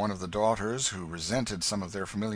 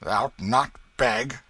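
loud, slow, incredulous echo; surprised exclamation; 4 semitones higher, 8 dB louder, slower than usual. A narrator's reading. Hugo astonished by the King's refusal, exclaiming. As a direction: throw the refusal back in disbelief. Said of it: "exclaimed Hugo, eyeing the King with surprise".

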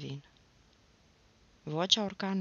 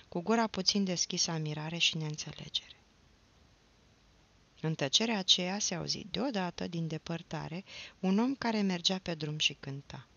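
plain reading voice

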